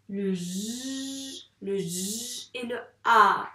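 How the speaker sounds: J as a long "zh", A held long; sounded-out letters. Shown as a demonstration. Teacher showing the two letters of a syllable, each sound stretched. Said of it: "On va faire le son de ces deux lettres".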